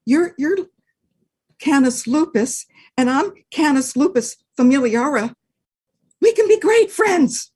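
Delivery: high, hopeful dog voice; eager little dog. Acted. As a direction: win the wolf over eagerly. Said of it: "Dog said".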